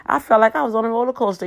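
high, loud and fast; high, quick, loud roller coaster story; excited telling. Real, not acted. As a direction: rush it out, still shaken up. Describing bumpy road ride.

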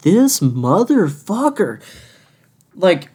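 high, drawn-out disbelief; incredulous. Retold or imitated. Cashier reacting to customer who sat down, incredulous. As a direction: stretch each word in retold outrage.

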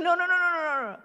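put-on high, pleading run of no's from a kid; a light mock of a frantic kid; imitating panicked child. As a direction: mimic a child begging not to be told on. Child begging, don't tell Daddy.

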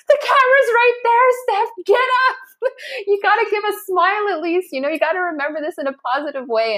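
teasing friend. Retold or imitated. A friend tells a collapsing runner to smile for the camera, high and teasing. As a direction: tease her to get up and smile.

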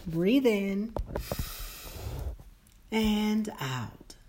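soft, calm meditation guide voice; slow and soothing, lead them inward; guided meditation. Acted.

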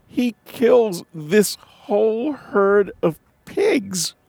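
slow, high, drawn-out disbelief; incredulous. Real, not acted. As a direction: stretch it out in mock disbelief. Mocking the sermon's story.

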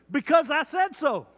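high, loud fatherly final word; dad voice. Retold or imitated. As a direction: end the argument like a stern dad. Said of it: "What about this one?".

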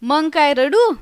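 amused retort breaking into laughter; laughing. A narrator's reading. Guest answering the storyteller's complaint, laughing. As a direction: say it through amusement, then laugh. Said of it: "पाहुणा हसत हसतच म्हणाला".

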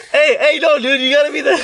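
very high, loud laughing mock customer. Friend hamming up the customer, very high and loud. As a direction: squeal it like the confused customer.

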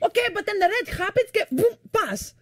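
very high, fast retort; mom impression. Mom arguing about the red light, high rapid-fire retort. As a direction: fire back as an exasperated mother. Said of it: "And then she's like".

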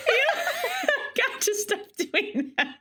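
giggling through the words; can't stop laughing while talking. Real, not acted.

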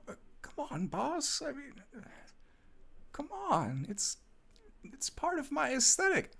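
high, soft wheedling voice; whiny pleading. Acted. Underling begging boss to let him keep coat, high whiny pleading. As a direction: plead weakly in a thin high voice.

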